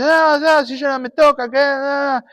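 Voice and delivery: put-on high, indignant little boy's protest. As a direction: protest shrilly like a wronged kid. Pedro protesta agudo. (niño protestando agudo e indignado)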